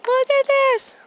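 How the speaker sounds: high, loud cry of wonder; thrilled. Real, not acted. To her viewers, marvelling at the planets, thrilled. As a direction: burst out in delight at the sky. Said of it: "and look at this".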